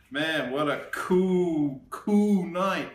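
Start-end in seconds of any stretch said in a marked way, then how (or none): none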